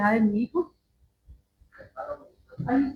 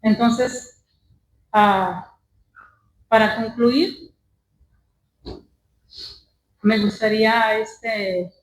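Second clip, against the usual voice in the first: louder preaching exhortation; 5 dB louder than usual. Acted. Preacher urging congregation to forgive, louder. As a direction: urge the church to forgive.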